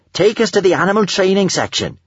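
high, eager command to a pet; high-pitched take-charge character voice; bossy young adventurer. A narrator's reading. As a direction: give the order high and keen. Child heroine ordering her dragon.

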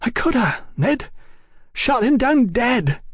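high, thin, pleading voice; confused appeal. A narrator's reading. Young brother appealing to his elder, confused and pleading. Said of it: "confused appeal".

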